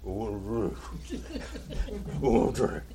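usual speaking voice

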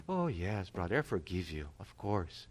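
a quiet, tender mock reply to a repentant brother; gentle forgiveness. Forgiving a brother who apologized.

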